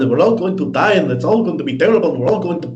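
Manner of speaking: high-pitched mock doomsaying activist; imitating the town hall woman's frantic rant, hammed-up; mocking weird accent. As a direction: lampoon her panicked climate rant. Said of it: "in this weird accent".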